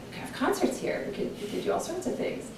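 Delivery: soft, high, half-whispered musing; retold hushed idea. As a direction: relive the thought in a light near-whisper. Retells own thought on walking in.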